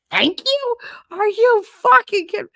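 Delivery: high, loud incredulous cry; can't believe he asked; outraged disbelief. Retold or imitated. Mocking his request for thanks, outraged disbelief.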